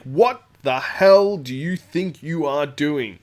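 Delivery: slow, loud, measured fury; stern angry demand. Retold or imitated. Ian storming over to confront Goliath, stern. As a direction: bear down on him with each word. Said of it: "and he's like".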